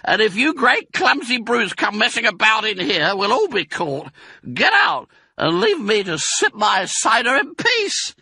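high, loud rat voice scolding; shrieking Rat. Acted. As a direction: squeal at the clumsy brutes to get out. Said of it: "shrieked Rat".

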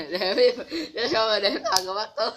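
laughing. Real, not acted. Laughing through words.

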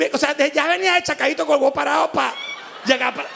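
ordinary talk

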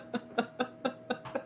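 breathy, helpless laugh; soft unvoiced laughing fit; laughing at herself. Real, not acted. Reader cracking up at herself, breathy laughing. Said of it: "I gotta laugh at myself".

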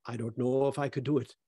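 Jim's words in a shaky attempt at an Irish accent; lightly put-on Irish accent, half-hearted; bad Irish accent. Employee answering HR about dropping swearing, in a weak Irish accent. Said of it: "That was not a good Irish accent I just did".

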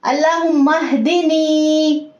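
अल्लाहुम्मा हदिनी slowly, the दी held for two counts; हदिनी recited with a held दी; correct two-count madd. Shown as a demonstration. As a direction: stretch the दी of हदिनी. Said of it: "two seconds के लिए खींच के पढ़ते हैं".

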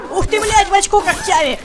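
yelling in shock, high and loud; loud exclamation. Real, not acted. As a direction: blurt it out at the top of your voice. Ярость во время боя. (громкий возглас)

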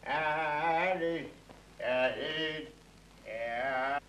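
put-on slurred drunk bravado, rising and fading. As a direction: drunk man boasting then drifting off. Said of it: "يا مسطول". (سكران بيتفاخر وصوته بيتقل)

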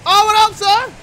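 high, loud holler; excited greeting. Pretend. Dad greeting his revived son, excited. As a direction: burst out at seeing him wake.

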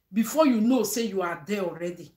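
ordinary talk, nothing marked

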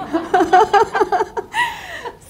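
high, loud laugh bursting out; real burst of laughter. Real, not acted. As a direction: crack up at the absurd upgrade. Laughs at her own story.

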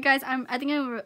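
ordinary talk

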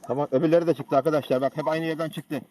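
calling out. Real, not acted. Hunter calling to the team.

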